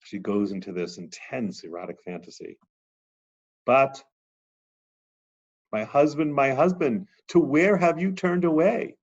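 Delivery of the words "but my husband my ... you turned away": high, louder cry of abandonment; anguished cry. Acted. Teacher voicing the Shekhinah calling her vanished husband, anguished. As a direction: call out for the missing husband.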